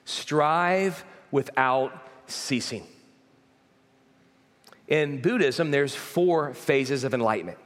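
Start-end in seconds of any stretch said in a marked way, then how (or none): 0.0-2.8 each word drawn out slow and heavy; slow solemn quote. Retold or imitated. Preacher quoting Buddha's last words, slow and grave. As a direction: Strive without ceasing, slow, weighty. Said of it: "his final words were".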